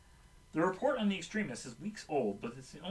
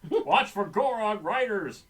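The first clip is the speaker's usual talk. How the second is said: loud, slow, higher-pitched shout; yelled warning; 6 semitones higher, 8 dB louder, slower than usual. A narrator's reading. Gadd warning the crew of riders, yelled warning. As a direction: shout the warning, stretching the words. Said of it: "Gadd yells".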